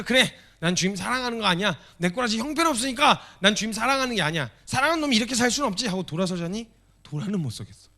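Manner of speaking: loud, high, fast building cry; impassioned preaching. Acted. Preacher voicing Peter's anguish, loudly. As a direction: pour out the despair.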